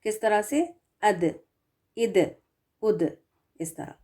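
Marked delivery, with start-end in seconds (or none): 1.0-3.2 अद, इद, उद with the final द released in a short echoing bounce; bouncing final d. Shown as a demonstration. Teacher shows the qalqala echo on a resting d. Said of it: "आवाज़ जो है वो bounce होकर आती है".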